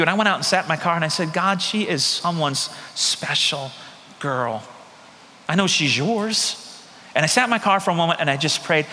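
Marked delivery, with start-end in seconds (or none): 1.3-6.6 hushed prayer. Retold or imitated. Quiet prayer in the car.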